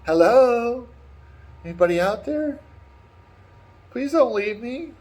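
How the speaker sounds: high, plaintive calls into emptiness; abandoned damsel. Retold or imitated. Woman left alone in tower, high pleading calls. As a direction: call out hopefully, then plead. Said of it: "you hear her like".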